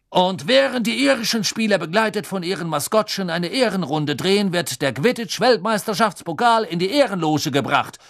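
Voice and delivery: high, booming stadium announcement; thundering announcer. Acted. Bagman announcing the trophy to the crowd, booming high announcer voice. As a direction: boom it across the stadium. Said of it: "donnerte Bagman".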